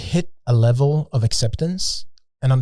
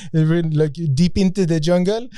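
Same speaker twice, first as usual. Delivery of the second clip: pitched-up imitation of a French speaker; French accent; 4 semitones higher than usual. Guide selling a jungle trip to tourists, French accent. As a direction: keep up the mock French accent. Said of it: "it was a French accent".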